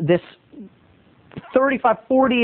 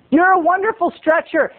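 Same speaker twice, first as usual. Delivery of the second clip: high, mock-admiring call-out; teasing kids; 10 semitones higher than usual. Giggly kids call to the man stretching, teasing. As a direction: imitate kids teasing with praise. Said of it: "these two kids are cracking up".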